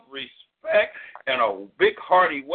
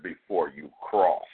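higher, dramatic poetry recital; spoken-word poem; 6 semitones higher than usual. Acted. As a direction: perform it like a preaching poet. Recites his spoken-word piece.

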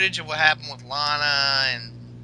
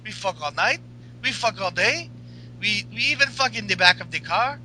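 put-on higher, louder Russian-accented woman; Russian accent Lana; 8 semitones higher, 6 dB louder than usual. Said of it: "Lana's like".

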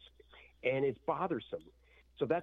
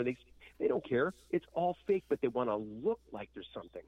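ordinary talk, nothing marked

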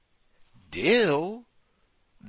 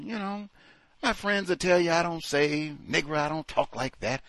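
Ashley; 4 dB quieter than usual. Acted. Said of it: "Then Ashley said".

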